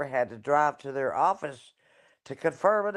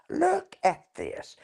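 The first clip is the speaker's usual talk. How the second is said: high, slow, delighted look at this; excited high call; slower than usual. Real, not acted. Showing beach view, excited.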